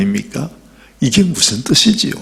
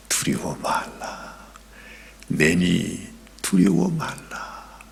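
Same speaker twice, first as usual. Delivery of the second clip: low, slow whisper; soft whispered comfort; hushed reassurance; 7 semitones lower, 11 dB quieter, slower than usual. Acted. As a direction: breathe it calmly into the storm. Jesus calms disciples in the storm.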